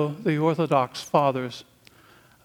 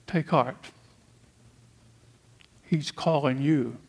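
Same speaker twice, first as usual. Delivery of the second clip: gentle invitation. Acted. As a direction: tell the listener kindly that he is calling. Preacher repeats the crowd's words.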